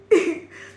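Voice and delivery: short high laugh. Real, not acted. Laughing at imagined slap.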